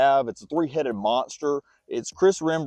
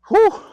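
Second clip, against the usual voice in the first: loud high breathy exhale of relief; winded whew; 9 dB louder than usual. Real, not acted. Vlogger catching his breath mid-walk, loud whew. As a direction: blow out a big tired whew. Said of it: "I'm out of breath".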